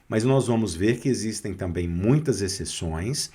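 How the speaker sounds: ordinary delivery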